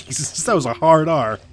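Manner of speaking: loud, high-pitched shock with a laugh; shocked outburst. Real, not acted. Co-host reacting to a shocking story, shocked and loud. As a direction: blurt it out in laughing disbelief.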